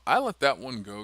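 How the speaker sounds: ordinary delivery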